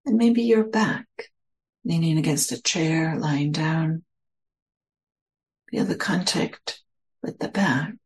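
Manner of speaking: measured meditation voice, phrase by phrase; guided meditation. Real, not acted. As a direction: guide listeners into stillness, leaving space between phrases.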